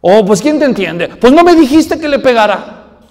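put-on high, loud, put-out little boy; the kid throws his dad's advice back at him; indignant child. Niño reclamándole al papá su propio consejo. Child protests father's contradiction.

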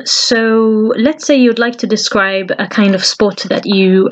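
normal talking voice

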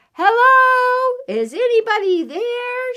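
high, loud calling shout. A narrator's reading. Mouse calling into the enormous hole, high shout. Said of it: "she shouted".

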